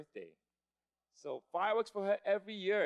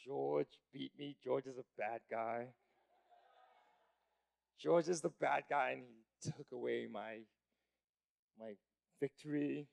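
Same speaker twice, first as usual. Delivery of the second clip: slow, lower, softer sulking; mock dejection; 5 semitones lower, 5 dB quieter, slower than usual. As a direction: sulk like a sore loser. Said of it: "I'm like".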